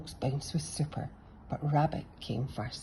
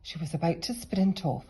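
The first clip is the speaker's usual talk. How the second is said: plain reading voice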